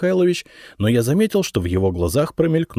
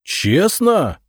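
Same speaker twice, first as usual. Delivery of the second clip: возмущённо взревев, резко выше; рявкнуть слово с возмущением; рёв; 12 semitones higher, 5 dB louder than usual. A narrator's reading. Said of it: "взревел Зотов". (гневный рёв)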